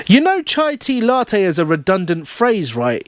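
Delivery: high-pitched pedantic spiel; lecturing customer. A narrator's reading. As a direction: launch into a know-it-all lecture. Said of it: "he launches into a whole spiel".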